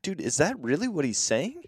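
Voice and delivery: retold confusion. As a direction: ask in puzzled disbelief. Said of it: "I'm so confused".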